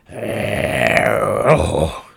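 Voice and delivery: "Oh" loud and drawn out, as a showy Spanish word; cool-sounding Spanish. Shown as a demonstration. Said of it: "that sounds cool".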